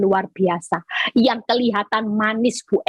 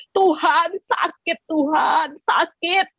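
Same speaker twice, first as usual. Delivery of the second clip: much higher, aching cry of pain; tearful plea; 8 semitones higher, slower than usual. Pretend. Preacher acting a hurting believer weeping to God.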